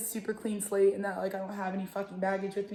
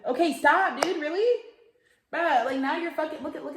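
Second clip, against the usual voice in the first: sharp, high, louder "stop, dude"; scolding the dog; 6 semitones higher, 4 dB louder than usual. Real, not acted. Scolding her dog for eating her braid, exasperated and loud. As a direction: snap at the dog to quit it.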